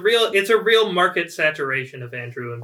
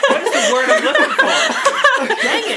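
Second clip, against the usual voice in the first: much higher and louder, laughing at losing a word; 8 dB louder than usual. Real, not acted. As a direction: laugh through the frustration. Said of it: "I just lost the word".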